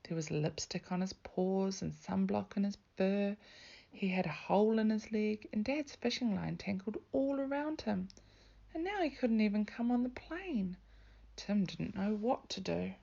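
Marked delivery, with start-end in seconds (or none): none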